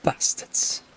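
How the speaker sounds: Bastards with short northern a; northern accent. Shown as a demonstration. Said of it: "sounds better in a northern accent".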